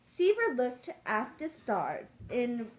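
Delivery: lively storybook read-aloud; reading to children. Acted. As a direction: read it like a picture book to kids.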